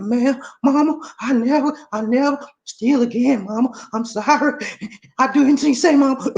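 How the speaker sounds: put-on high, pleading little boy voice; mock sorry kid begging mama; boy promising his mother to behave; pleading child. Reformed boy begs mother.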